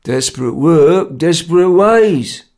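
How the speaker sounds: loud, slow, drawn-out pronouncement; unmoved, weighty Cockney reply; laconic. Acted. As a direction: state it slowly and curtly. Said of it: "Jerry replied laconically".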